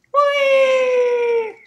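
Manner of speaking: high gleeful whee; long high sliding whee. Real, not acted. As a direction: squeal with delight on the way down. Sliding down toward lava.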